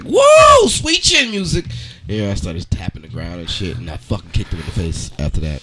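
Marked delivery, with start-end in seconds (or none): 0.0-1.6 high, loud gleeful whoa; fanboy shout at the shirt; excited whoa. Retold or imitated. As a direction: yelp it like a hyped wrestling fan. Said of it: "I was like".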